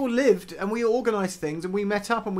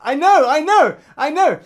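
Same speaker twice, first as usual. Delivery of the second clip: much higher and louder, eager agreement; emphatic agreement; 10 semitones higher, 10 dB louder than usual. Real, not acted. Host eagerly agreeing with co-host about banking in person, much higher and louder. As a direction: jump in to agree.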